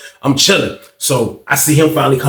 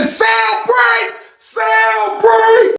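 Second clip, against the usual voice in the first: high shouted announcement from across the pod; calling out; much slower than usual. Retold or imitated. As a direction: yell it like a guard calling the break, much higher. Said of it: "Lady said".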